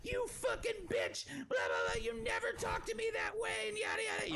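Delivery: very high, shrill screaming caricature; mock drunken scream. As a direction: screech like the furious drunk sister. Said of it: "she's screaming at the top".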